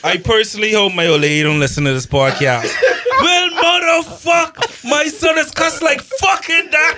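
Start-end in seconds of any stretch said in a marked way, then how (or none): 3.2-7.0 mock shout. Mock outraged mother.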